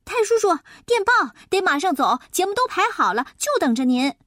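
high, fast excited shout. Acted. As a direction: yell the news through the window. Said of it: "大叫大嚷".

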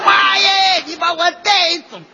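high shouted mock wail; retold funeral wail. Mother recalled wailing at grandma's funeral, mock shouted cry. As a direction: imitate mom's staged mourning howl. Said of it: "你妈妈把头发一解开".

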